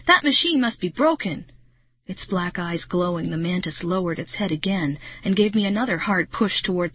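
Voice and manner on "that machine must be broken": higher-pitched alarmed call; Marty shouting. A narrator's reading. Marty about the attacking machine, shouting. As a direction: raise the pitch, calling out in alarm. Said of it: "I heard Marty shout".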